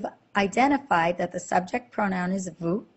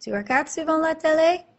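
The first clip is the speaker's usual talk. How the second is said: high rising question tune on "télé"; "télé?" lifted high at the end; question intonation; 8 semitones higher, 3 dB louder than usual. Shown as a demonstration. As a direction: make it a question with your voice. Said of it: "change the pitch of our voice".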